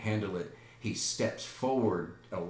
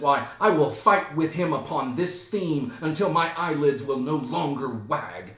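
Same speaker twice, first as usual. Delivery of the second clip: loud, raised declamation; defiant vow; 5 semitones higher, 7 dB louder than usual. Acted. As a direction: vow to fight, theatrically heated. Hamlet insists he will fight.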